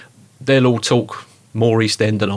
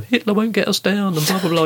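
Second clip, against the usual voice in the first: mock cheery wartime Cockney, pitched way up; chirpy Cockney; 10 semitones higher than usual. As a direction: lampoon the plucky Blitz spirit, high and bright. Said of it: "very positive spirit".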